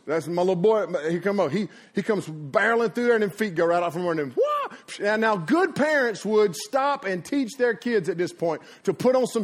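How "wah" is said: very high mock "wah"; falling boy's yelp. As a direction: squeal like a kid whose feet fly out. Imitates his boy slipping.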